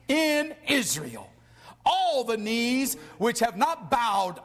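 high start, slowing toward the end; fervent scripture reading. Acted. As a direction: proclaim the verse with weighty pauses. Reads scripture with fervor.

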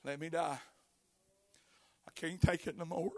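weary despairing prophet, low and soft; defeated Elijah. Acted. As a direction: sound like a man who's given up. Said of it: "And here he's saying".

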